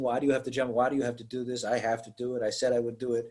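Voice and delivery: low, soft back-and-forth; understated lovers' argument, murmured; soft retold exchange. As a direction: quietly imitate a resigned couple.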